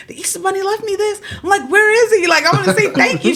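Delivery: put-on high eager kid voice; excited child. Little girl looking for the bunny, high and eager. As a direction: play the thrilled little girl.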